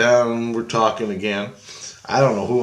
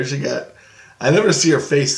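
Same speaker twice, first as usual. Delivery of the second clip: ordinary talk, nothing marked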